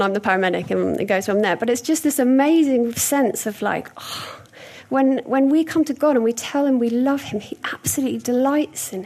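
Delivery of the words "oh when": ordinary delivery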